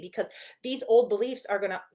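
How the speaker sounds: ordinary talk, nothing marked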